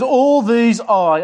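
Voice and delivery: slow and loud, high pitched, leaning on "I"; "All these I" drawn out with "I" underlined; stress on I. Acted. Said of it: "underline the word I".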